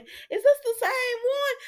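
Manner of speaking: very high squeaky disbelief; mock amazement. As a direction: squeal the question in playful astonishment. Acts out recognising the actress.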